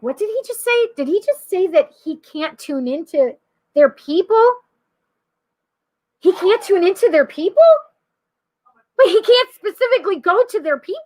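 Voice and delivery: acted-out astonished whisper-to-friend questions, voice jumping up; incredulous questioning. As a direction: pitch climbing in mock disbelief. Acting out her stunned questioning.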